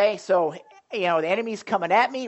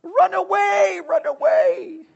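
high, drawn-out yell of fleeing knights; panicked retreat shout; 11 semitones higher, 6 dB louder, slower than usual. Retold or imitated. Knights fleeing battle yelling in panic, high comic shout. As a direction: shriek it like a cowardly Monty Python knight. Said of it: "they'd always go out of the battle going".

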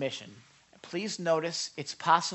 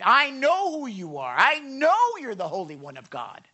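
raised voice, stretched emphatic words; demonic spirit crying out; 7 semitones higher, 6 dB louder than usual. Acted. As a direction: cry out as a demon recognizing holiness. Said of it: "This demonic spirit from this guy says".

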